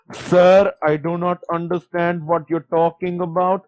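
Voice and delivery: put-on brusque caller in an Indian accent. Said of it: "And it's like".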